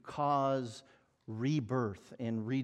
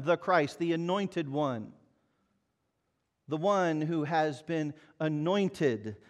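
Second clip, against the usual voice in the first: ordinary talk, nothing marked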